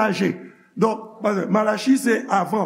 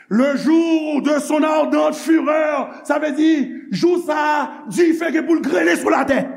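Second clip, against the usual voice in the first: raised preaching voice on fury; preaching shout; 5 semitones higher than usual. Acted. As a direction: thunder out God's burning anger. Preacher thunders God's fury.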